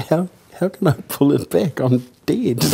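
high, half-laughing disbelief; mock-baffled, pitched well up; incredulous retort. Actor asks the director how a dead man pulls back, high and incredulous. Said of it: "And I said".

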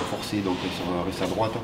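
ordinary talk

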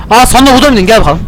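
normal talking voice

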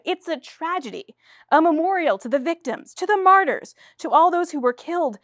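high, loud, impassioned grief; overcome with emotion. Acted. Playwright defending her play to the producers, high, loud and grieving. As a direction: plead for the victims with rising feeling. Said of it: "overcome with emotion".

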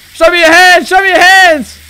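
loud, high shouted order; police command. Pretend. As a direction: bark at the suspect like a cop. Cop commanding a suspect.

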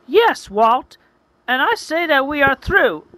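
high, loud heroine voice; shouted breakup. A narrator's reading. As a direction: shout it high, cutting him off for good. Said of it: "she shouts out to him".

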